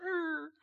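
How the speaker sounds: high, strained mock groan; mock pained grunt. Preacher acting out his brother's punch landing, mock grunt. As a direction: imitate being winded by a stomach punch.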